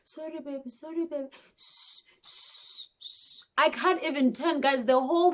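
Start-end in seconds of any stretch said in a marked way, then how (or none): 0.0-1.3 soft, tender apology; soothing baby. Retold or imitated. Mother soothing her crying newborn, soft and tender. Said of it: "I'm like". 1.6-3.4 soft unvoiced shushing; hushing. Mother hushing her crying newborn, whispered.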